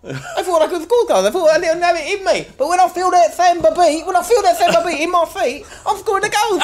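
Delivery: high, laughing, cocky striker; Lucas Moura impression. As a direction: giggle through the boasts. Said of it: "It's me. Lucas Moura".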